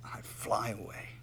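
slow, breathy whisper after the cry. Acted. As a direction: let it fade to a hush. Soft end of the sung-out wish.

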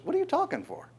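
high, incredulous mock question; a squeaky, amused divine rebuke; God's retort. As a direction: shoot the pitch way up in disbelief. God retorts to Moses.

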